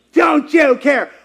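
loud, high, slowed plea; desperate cry. Retold or imitated. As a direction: yell the question out of fear. Repeating the disciples' cry.

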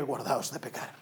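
solemn voice of God. Retold or imitated. Preacher mimicking God warning a young believer, deep solemn intoning. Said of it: "estás esperando que Dios te diga".

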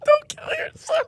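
shouting through laughter; cracking up mid-shout; laughing hard. Real, not acted. Laughing hard while mocking.

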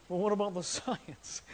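put-on mock skeptic voice with a laugh. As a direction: playfully mimic a doubting listener, laughing. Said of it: "I was just mocking you with that voice".

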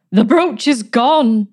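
drawn-out angry yell; furious shout. Acted. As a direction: shout the loss to the room. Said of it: "shouted Miss Granger".

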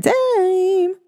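playful high slow sign-off; high drawn-out catchphrase. Shown as a demonstration. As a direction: stretch time up high like a tune. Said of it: "repeated catchphrase, sung up high".

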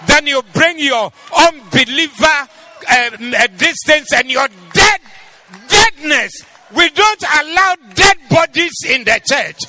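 very loud, high, driving sermon shout; full-force shouted preaching. Real, not acted. Preacher denouncing dead bodies in the church, shouted preaching. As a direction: thunder it out to the whole congregation.

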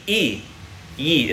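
"E" held as a clear long ee; iota vowel sound. Shown as a demonstration. Teacher sounding out "E" for the class. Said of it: "which is like a E".

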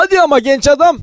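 dubbing actor impression. Shown as a demonstration. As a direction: project it like a dubbed film buddy chiding a friend. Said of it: "Biraz Mustafa gibi olur musun". (abartılı, yüksek sesli dublaj taklidi)

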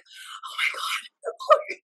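very high, very slow, breathless whispered gasp; jittery caffeine whisper. Retold or imitated. Over-caffeinated in Italy, a high whispered oh my God. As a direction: let the words wheeze out high and stretched. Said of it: "at this point I'm like".

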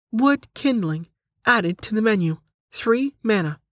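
high, measured system voice; menu announcement. Acted. Menu confirms Delta's new item, high announcing voice.

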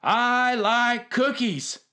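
high, very slow yell stretched across the field; ringing shout. Retold or imitated. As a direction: belt it so the whole stadium hears. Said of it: "could hear a voice ring out".

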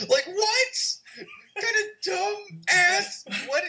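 high, laughing disbelief; incredulous laughing. Real, not acted. Mocking absurd movie scene.

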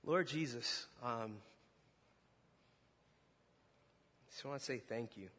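low, soft, steady prayer voice. Real, not acted. Preacher praying over the congregation, soft and low.

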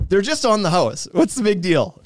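ordinary talk, nothing marked

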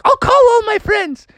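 very high, bubbly mother; a mom thrilled by a dance class; squeaky high excited mom. Acted. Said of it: "And she's like".

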